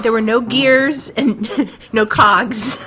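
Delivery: laughing through the words, slowly; chuckling while denying it. Real, not acted. Amused denial about steampunk costumes.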